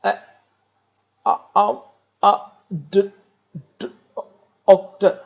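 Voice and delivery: halting uhs and broken word starts; telegraphic speech demo. Shown as a demonstration. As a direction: stumble through like a broken telegraph. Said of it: "A person like talks like this".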